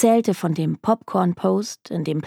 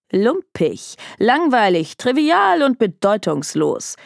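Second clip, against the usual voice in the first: emphatic list, building higher; scornful; 3 semitones higher, 3 dB louder than usual. A narrator's reading. Detective confirming his insult to a shocked client, scornful. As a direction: hammer out each dismissive adjective.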